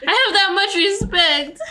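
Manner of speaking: high, loud laughing through words. Real, not acted. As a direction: crack up while saying it. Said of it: "am I having a stroke".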